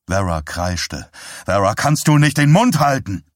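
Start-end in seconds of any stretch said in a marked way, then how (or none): 1.4-3.2 louder, higher, exasperated; husband snaps at his praying wife, shouted; shouted rebuke. Acted. Herb snaps at shrieking wife.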